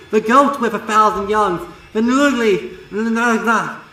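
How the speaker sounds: loud, high, panicked raving; raving in terror, stuttering at the end; frantic terror. Acted. Twilight warning Starlight of the old ones, frantic and loud.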